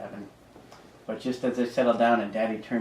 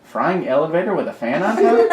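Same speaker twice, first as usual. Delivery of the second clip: high, loud, giggling; asked, laughing; 6 semitones higher, 8 dB louder than usual. A narrator's reading. Sleepy boy garbling words, laughing. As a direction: ask it through giggles. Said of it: "asked the sleepy Dagan".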